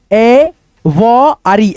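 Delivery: "ए... व... आर ई" very slowly, part by part; broken into sounds. Shown as a demonstration. Teacher sounds out every for learners, slowly in pieces. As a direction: stretch and separate "ए व आर ई". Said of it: "इसे पढ़ेंगे हम लोग".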